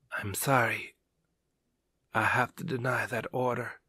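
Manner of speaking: faint, breathy dying whisper; weak whisper. Acted. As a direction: barely get the words out. Dying man refuses order.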